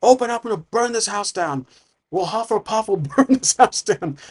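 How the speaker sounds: high, strained mock yell; acted bandit shout, pitched well up; bandit threatening. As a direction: holler threats through the door, half in jest. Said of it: "And he's knocking".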